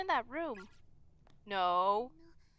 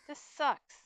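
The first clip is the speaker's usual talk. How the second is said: tearful; 2 semitones higher, 5 dB louder than usual. Acted. Mayu near tears.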